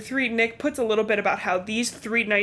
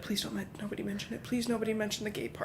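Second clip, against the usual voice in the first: mock-nervous hushed plea, much softer; hushed pleading; 10 dB quieter, faster than usual. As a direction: beg them in a near-whisper not to mention it. High schoolers begging to hide it.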